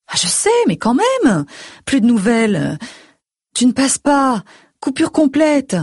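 high-pitched fretting mother voice; reproachful mother. A narrator's reading. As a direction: pile up the complaints as an anxious mother.

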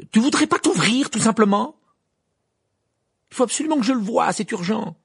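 loud, high-pitched exasperated plea; urgent pleading. A narrator's reading. Harry begging the gargoyle to open, urgent and loud. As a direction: demand it urgently, voice pushed right up.

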